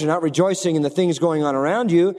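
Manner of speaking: normal talking voice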